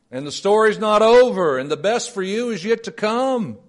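high, loud, jubilant pulpit voice; exultant sermon peak; joyful preaching. Acted. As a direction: lift them up with gleeful fervor.

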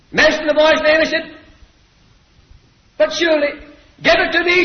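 high, loud, impassioned pleading; boy's urgent plea. Acted. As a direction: cry it out, begging to take the punishment. Preacher voices boy pleading to take punishment.

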